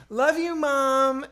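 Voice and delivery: high, very slow sing-out to mom; kid calling back. Retold or imitated. Boy answering his mom at drop-off, sing-song. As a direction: call it back loud and stretched. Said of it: "And he goes".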